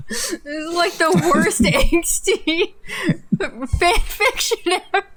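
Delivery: can barely talk through laughter; real laughing fit. Real, not acted. Reader cracking up at the fan fiction, laughing. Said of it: "I gotta get over this one".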